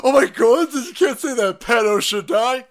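high, loud outraged mock voice; mocking angry lefty. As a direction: shriek it like a scandalized leftist. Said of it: "The, the angry lefties".

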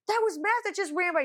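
high-pitched, alarmed report; freaking out. Retold or imitated. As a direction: act out someone flustered and startled. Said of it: "Everyone's freaking out".

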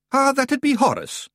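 very high, offhand Scots; Scottish clan leader. A narrator's reading. Rob introducing a cheese, high airy Scots.

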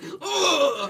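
high, laughing mock grunt of pain. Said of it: "he was just like".

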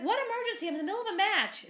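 high, loud whiny protest; mock annoyed gamer. Roommate told to evacuate, whining high and loud. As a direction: play the irritated roommate who won't leave her match.

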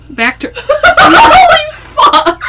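loud, high, slow laughing yell; startled shout. Real, not acted. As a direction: jump with fright then laugh.

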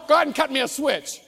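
acted punishment threat; threatening parent. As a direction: order it like a fed-up parent. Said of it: "you don't have to yell".

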